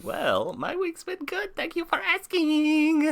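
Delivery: high, drawn-out falsetto reply; silly put-on voice. Podcast host answering friends about his week in a silly high voice. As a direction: squeak it out in an exaggerated falsetto. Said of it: "why I did that voice".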